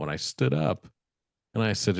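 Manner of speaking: ordinary talk, nothing marked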